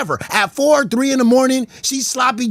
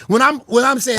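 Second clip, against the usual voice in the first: usual speaking voice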